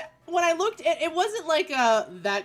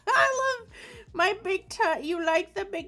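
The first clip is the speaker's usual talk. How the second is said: mock old person; 11 semitones higher, 4 dB louder than usual.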